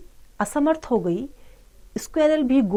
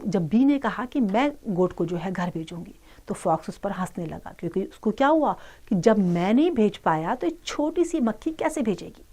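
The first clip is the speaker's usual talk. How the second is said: ordinary delivery, nothing marked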